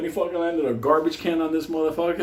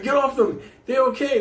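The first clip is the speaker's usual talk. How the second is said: mock cop calling off; 8 semitones higher than usual. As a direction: wave them off like a cop. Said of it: "he was like".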